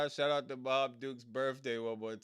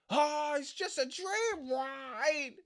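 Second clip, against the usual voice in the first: high, slow mocking falsetto; mock Chad Gable; 14 semitones higher, slower than usual. Streamer mocking the wrestler's entrance line, high falsetto parody. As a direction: sneer the line in a high, drawn-out voice.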